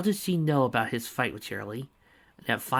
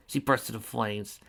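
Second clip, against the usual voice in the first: ordinary delivery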